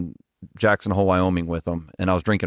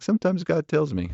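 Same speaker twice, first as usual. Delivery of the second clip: high-pitched impression of an old mentor pastor; lightly mimicked pastor, much higher than usual; imitating the pastor; 8 semitones higher than usual. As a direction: put on his voice, pitched well up. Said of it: "he talks like this".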